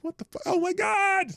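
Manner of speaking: high, startled cry of disbelief; retold shock. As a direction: jolt up in pitch like you just saw it. Retelling watching a beheading video.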